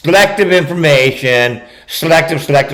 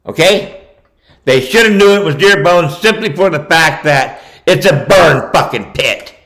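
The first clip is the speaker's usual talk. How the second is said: ordinary talk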